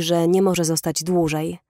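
ordinary delivery, nothing marked

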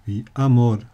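Amor with the stress punched on the last syllable, a-MOR; final stress. Shown as a demonstration. Teacher stresses Amor on the last syllable. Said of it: "auf der letzten Silbe betont".

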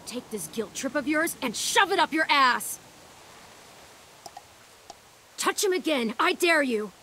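high, furious confrontation; angry yell. Acted. Girl standing up to a bully, high furious yell. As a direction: lash out, voice pitched high with fury.

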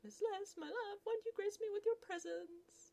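high, sing-song lovestruck call; high lovesick gentleman; courtly suitor. Acted. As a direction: woo her through the door. Suitor calls sweetly through door.

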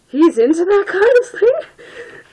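very high, loud squeal; slow, shrieking incredulity; appalled. Real, not acted. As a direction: squeal in disgusted disbelief. Disgusted at the story.

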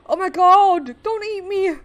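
loud, high cry of panic; alarmed high-pitched pleading; frightened plea. Real, not acted. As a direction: beg in a high, scared voice. Pleading with sea creature.

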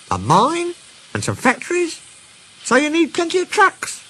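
high, lively talking-engine voice; high-pitched storybook engine; Stepney the engine, eager. A narrator's reading. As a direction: list it all in a high, admiring sing-out.

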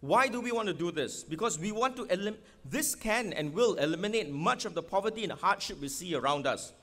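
ordinary delivery

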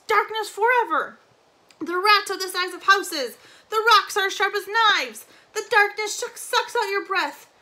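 high, loud raving of a madman; frantic, howling despair; crazed wailing shout. A narrator's reading. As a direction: wail doom at a crowd, building to a howl. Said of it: "resumed his terrible shouting".

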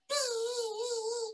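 very high buzzing; mosquito buzz. Acted.

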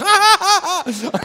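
loud, high burst of imitated laughter; imitated joy outburst. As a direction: suddenly erupt in hammed-up laughter. Imitates the man's outburst again.

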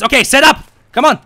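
loud, high squad order; urgent shout. Real, not acted. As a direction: bark it at the squad. Firefight begins, ordering squad.

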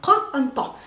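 isolated "qa" from the throat, then heavy "ta"; guttural sounds. Shown as a demonstration. Teacher isolating both sounds, guttural qa and ta. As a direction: sound out qa and ta with backed "ah" vowels. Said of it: "Both guttural sounds".

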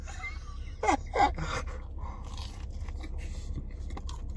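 long silent wheezy laugh; breathless laugh; wheezing laugh. Real, not acted. First bite, long wheezing laugh.

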